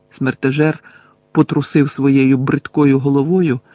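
ordinary delivery, nothing marked

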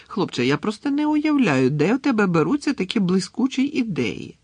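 high, delighted exclamation; gushing admiration. A narrator's reading. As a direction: marvel loudly at a brilliant pupil. Teacher gushes over student's potion.

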